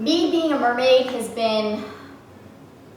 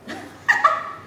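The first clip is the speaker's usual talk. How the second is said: very high, squeaky little laugh; giddy giggle. Real, not acted. Unboxer delighted by mermaid top, giddy laugh. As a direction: let out a thrilled titter.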